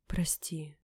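soft husky whisper; hoarse whisper. Acted. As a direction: breathe an apology, barely voiced. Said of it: "шепнула сипло".

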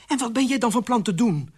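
high, loud impatient question; exasperated challenge. Acted. Queen challenges the idle king, high and exasperated.

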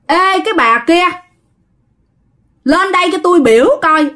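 lightly acted order to come here; summoning call. A narrator's reading. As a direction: call her over from the other room. Possessed son summons his mother.

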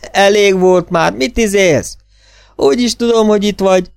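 high, loud calling out; exasperated shouting. A narrator's reading. Emerson yells at hiding Gordon, exasperated shout. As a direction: yell into the dark, fed up.

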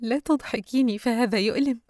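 pained laugh. A narrator's reading. Yusra, hurting, begs her friend not to make her laugh, pained laugh. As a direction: laugh through pain, pleading. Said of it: "ضحكت يسرى بتوجع قائلة".

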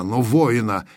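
plain reading voice

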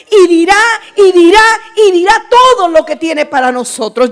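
loud, building preacher's proclamation; fervent preaching. Real, not acted. Preacher rousing the congregation, loud and fervent. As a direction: proclaim it with rising fervor. Preaching climax, rising fervor.

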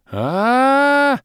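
high, loud sustained howl of despair; anguished wail. A narrator's reading. Bom and Zloba howling as the wine spills. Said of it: "Вопль Бома и злобы".

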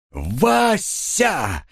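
high, drawn-out call of a name; joyful cry. A narrator's reading. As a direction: cry out in mock recognition, voice leaping up. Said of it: "закричал первый сын". (радостный протяжный вскрик)

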